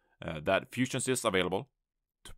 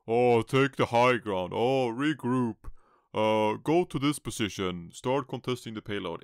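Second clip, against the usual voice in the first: bored shot calls; 4 semitones higher, 6 dB louder, slower than usual. Retold or imitated. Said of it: "those boring calls".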